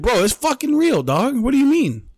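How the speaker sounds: high-pitched, incredulous protest; exasperated protest. Retold or imitated. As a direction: argue back with pitch jumping up. Retold argument with the clerk.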